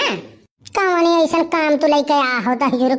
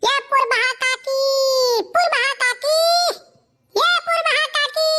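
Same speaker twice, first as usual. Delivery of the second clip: high, long calling cry across the yard; 12 semitones higher than usual. Acted. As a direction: call her name from outside the house. Calling out for aunt at house.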